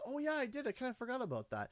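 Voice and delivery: high, light, sheepish admission; lightly voiced wife, quick and embarrassed; wife's voice. Wife admitting to husband she forgot, in a high sheepish voice. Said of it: "She goes".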